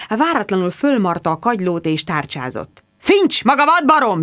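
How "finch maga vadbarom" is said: loud high bellow down the phone. Acted. Squire bellows at Finch on the phone. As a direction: roar into the phone. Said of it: "bőgte".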